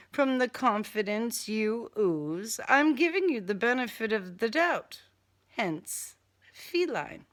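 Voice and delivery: usual speaking voice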